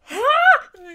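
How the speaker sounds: sharp, high, loud gasp of shock. Retold or imitated. Fan realizing Grogu will leave, sharp shocked gasp.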